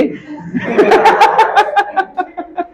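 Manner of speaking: long high-pitched laugh. Real, not acted. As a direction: burst out in a long, high giggle. Teacher laughs mid-lesson at own example. (ज़ोर की ऊँची, लंबी हँसी)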